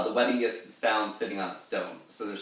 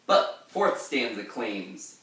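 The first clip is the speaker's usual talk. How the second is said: ordinary talk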